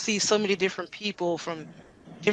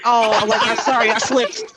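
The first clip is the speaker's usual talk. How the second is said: laughing apology; 2 semitones higher, 6 dB louder than usual. Retold or imitated. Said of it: "I'm like".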